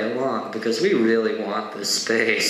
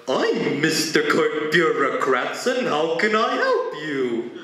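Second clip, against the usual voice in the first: slow, drawn-out pompous bureaucrat; mock the formal request, stretching every word; mock formality; 2 semitones higher than usual. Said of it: "mocking the formality of that question".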